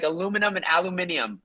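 "aluminum" American, then "aluminium" British with extra syllable; a-LOO-minum, then al-yoo-MIN-ium for "aluminium"; two pronunciations contrasted. Shown as a demonstration. Guest loving linguistics, contrasting "aluminum" with "aluminium". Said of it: "say it so subtly differently".

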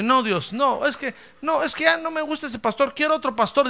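a fussy member begging God for another pastor, lightly whining; whiny church member. Pretend. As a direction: whine for a new pastor. Complaining church member voiced.

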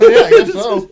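high, loud laughing reply. Real, not acted. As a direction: laugh through the words. Laughing agreement.

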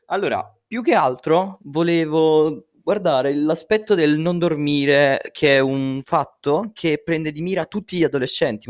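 usual speaking voice

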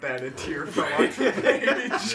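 slow, loud laughing line. Real, not acted. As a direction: drag it out while laughing. Joking, laughing.

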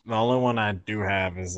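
normal talking voice

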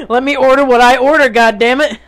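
loud, playful outburst; mock indignation. Mock outburst at correcting friends.

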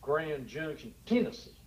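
Southern twang as said in Tennessee; Tennessee accent. Acted. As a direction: imitate the locals' Southern accent. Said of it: "Or as they say down there".

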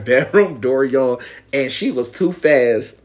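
ordinary talk, nothing marked

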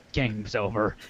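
Jigsaw verdict. Retold or imitated. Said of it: "And then it's like".

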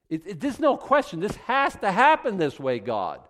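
loud, high, insisting with a nervous laugh; desperate insistence. Retold or imitated. Praying believer demanding God act, loud and high. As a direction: lean in hard, this has to happen.

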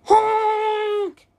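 high, held horn honk; long honking blast; truck honk. A narrator's reading. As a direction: imitate a big truck's horn. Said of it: "yelled the dump truck".

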